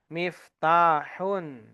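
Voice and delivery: miftahun drawn out slowly and clearly; slow model word. Shown as a demonstration. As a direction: spell miftahun out slowly with sound. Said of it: "maka itu bacanya adalah miftahun".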